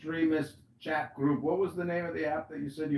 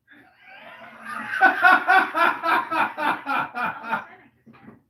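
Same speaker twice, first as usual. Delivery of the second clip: loud laughing shout; 10 semitones higher, 7 dB louder than usual. Real, not acted. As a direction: burst of shrieking laughter.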